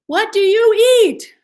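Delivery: high, loud, slow frog voice with an exaggerated mouth; big mouth frog. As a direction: ham up a wide-mouthed frog asking the alligator. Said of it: "he went to a, an alligator and said".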